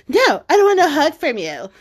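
hammed-up indignant protest with amusement; mock sulky refusal. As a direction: refuse like a huffy kid, playfully. Sulking daughter refuses hug, retold.

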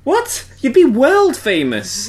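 amazed, drawn-out protest; incredulous. Real, not acted. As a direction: stretch out the disbelief. Mocking Maharaja treated as normal.

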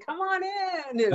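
high, drawn-out mock invitation; imitated beckoning call. Caller mimics the host inviting her in, high drawn-out call. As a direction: sing it out slowly, imitating the host. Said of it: "and then you're saying".

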